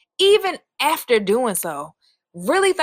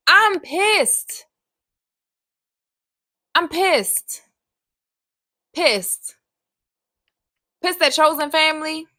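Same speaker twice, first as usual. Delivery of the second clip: angry outburst; 5 semitones higher, slower than usual. Real, not acted. Venting about her backup family.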